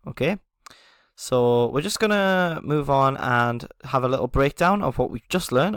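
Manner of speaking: ordinary talk, nothing marked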